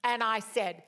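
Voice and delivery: normal talking voice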